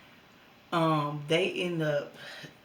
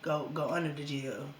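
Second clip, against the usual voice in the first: ordinary talk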